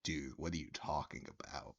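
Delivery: low, quiet deadpan incredulity; incredulous disbelief. Retold or imitated. Teller's silent reaction to the drunk patron, incredulous. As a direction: drop the voice low and soft, baffled. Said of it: "making a face of, like".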